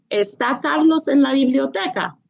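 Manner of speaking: ¿Está Carlos en la biblioteca? with está lifted high as a question; question tune. Shown as a demonstration. Teacher showing students question intonation. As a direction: ask it, lifting está. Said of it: "inflection of your voice changed in the word está".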